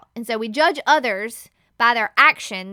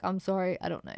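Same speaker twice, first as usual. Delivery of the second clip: low, quiet dude apology; man voice; 6 semitones lower, 9 dB quieter, faster than usual. Retold or imitated. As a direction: mumble sorry in a mock man's voice. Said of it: "your man voice".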